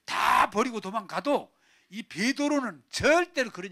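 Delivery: emphatic, loud vow; 베드로. Acted. Peter swearing he will never desert, loud insistent vow. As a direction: swear it forcefully. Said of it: "막 이랬단 말이에요".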